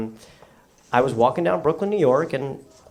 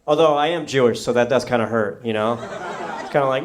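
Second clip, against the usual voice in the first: ordinary talk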